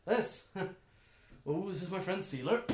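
a hint of a chatty elf; elven stranger. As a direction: introduce a companion in character. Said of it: "this elven individual".

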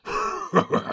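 sudden high harsh bark of a laugh; mocking cackle; short scornful orc laugh. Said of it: "laughed Ugluk".

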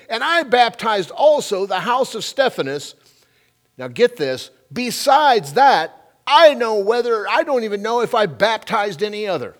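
loud, high-pitched scripture proclamation; scripture reading. Acted. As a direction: read it out forcefully, lifted over the room.

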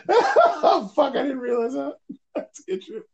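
high laughing realization; real surprised laughter; laughing surprise. Real, not acted. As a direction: crack up at the news, voice way up.

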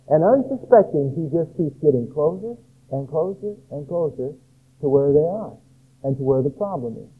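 usual speaking voice

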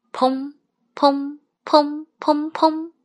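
high, even "peng" knocks; knocking imitation. A narrator's reading. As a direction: voice evenly spaced knocks on a door. Narrator imitates knocking on door. (模仿敲门声，音调偏高)

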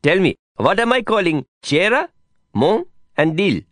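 high-pitched Indian friend quizzing his pupil; eager Indian teacher. Acted. Voices the Hindi-teaching friend.

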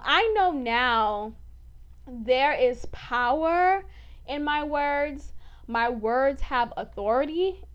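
slow, weighty, word by word; slow and emphatic, deliberate pacing; slow declaration. Real, not acted. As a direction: let each word land slowly. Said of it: "slow emphatic declaration".

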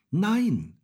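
high, loud cry of refusal; shouted refusal. A narrator's reading. Häwelmann refuses the old moon, shouting. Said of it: "schrie Häwelmann".

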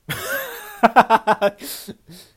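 big loud high laugh. Real, not acted. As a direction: burst out laughing loudly. Laughs at segment title.

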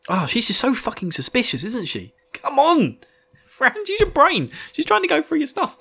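pleading with a clueless hero to wake up; exasperated yelling at Rand. Real, not acted. Podcaster shouting at Rand to use his brain, high exasperated.

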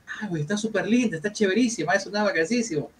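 a hint of a visiting guitarist gushing; impressed stranger.

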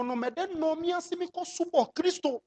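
normal talking voice

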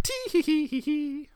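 very high, impish tee-hee; leprechaun-like giggle. Pretend. Completing the rhyme about Daddy's lap, impish leprechaun giggle. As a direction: squeak out a tiny mischievous giggle. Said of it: "I had the, the leprechaun-like part".

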